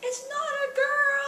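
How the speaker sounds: put-on a son refusing the girl costume; indignant little boy. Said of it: "It was like".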